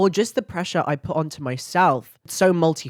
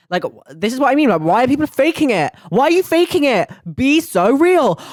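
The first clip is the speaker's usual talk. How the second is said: high, loud and fed up; high, loud exasperated outburst; exasperated rant; 9 semitones higher, 8 dB louder than usual. Real, not acted. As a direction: vent it, voice climbing high and loud. Ranting about people faking it.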